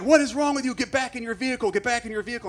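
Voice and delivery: high-pitched shouted orders; retold yelling. Border patrol yelling at a driver out of his car. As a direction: imitate officers yelling in alarm. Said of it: "they're all yelling".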